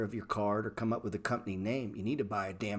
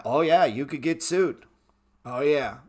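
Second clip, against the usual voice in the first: loud, higher mock lawyer, dismissive; 5 semitones higher, 9 dB louder than usual. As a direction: ham up a lawyer brushing it off. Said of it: "they're gonna tell you".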